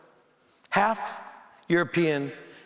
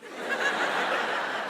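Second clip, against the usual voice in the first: a high burst of laughter; stunned laugh; 4 dB quieter than usual. Real, not acted. [name] reacts to the old man's news with a high laugh. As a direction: laugh out in disbelief.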